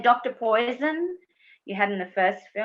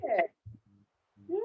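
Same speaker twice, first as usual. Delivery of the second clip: usual speaking voice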